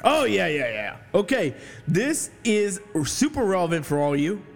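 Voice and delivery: ordinary talk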